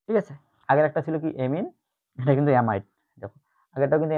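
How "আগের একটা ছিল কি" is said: ordinary talk, nothing marked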